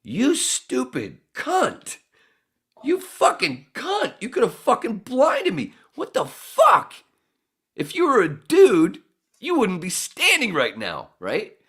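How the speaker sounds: high, loud, slow angry screaming; raging at the girl who hit his face with hair; furious yell. Retold or imitated. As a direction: tear into her in a rage. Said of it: "And I went".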